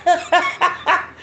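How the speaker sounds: high mock scream into laughter; drawn-out yelp, cracking up; comic pained scream. Host reenacts the oil burning her, comic scream.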